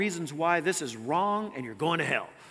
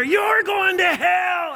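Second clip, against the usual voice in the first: high, stretched-out furious bellow; angrier, louder scream; 13 semitones higher, 10 dB louder, much slower than usual. Retold or imitated. Angered street preacher at a defiant driver, screaming louder. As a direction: bellow the condemnation slowly, pitched right up. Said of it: "he screamed louder".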